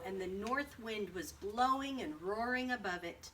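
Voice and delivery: usual speaking voice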